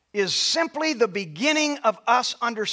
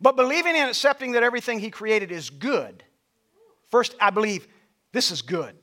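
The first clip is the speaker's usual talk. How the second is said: ordinary talk, nothing marked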